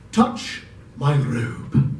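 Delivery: slow, low solemn command; grave order from the spirit; solemn ghost. Acted.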